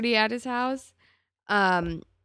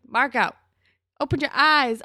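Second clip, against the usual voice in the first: loud, higher, emphatic order; exasperated scolding; 6 semitones higher, 8 dB louder than usual. Pretend. Viewer to Marco missing Dylan's interest, exasperated. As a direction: call it out loudly, fed up with him.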